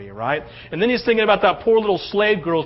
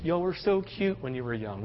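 fond memory; 4 semitones lower, 9 dB quieter than usual. Retold or imitated. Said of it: "just going".

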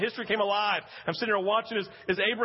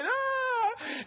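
very high, drawn-out "ah"; exhilarated cry. Retold or imitated. Preacher reliving the reunion, high exhilarated cry. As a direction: squeal with overwhelmed relief. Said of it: "oh, my exhilaration".

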